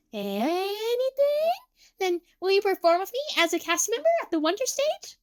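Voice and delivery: high, hopeful and eager; Emu. A narrator's reading. Emu seizes on Tsukasa's promise, high and eager. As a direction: stretch the first word into a rising, cute question.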